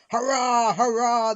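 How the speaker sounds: loud, high, long-held hurrah; roaring cheer. A narrator's reading. Runaway trucks breaking loose down the hill, roaring. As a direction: roar it out in wild triumph. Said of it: "they roared".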